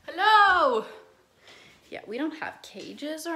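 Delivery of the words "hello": loud, high hello to the dog; calling out. Real, not acted. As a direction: call out a big high greeting. Greeting her dog upstairs.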